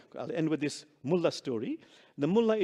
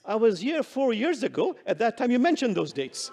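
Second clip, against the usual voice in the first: louder and higher punchline; 7 semitones higher, 6 dB louder than usual. Acted. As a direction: land the joke with gusto. Mullah's punchline delivery.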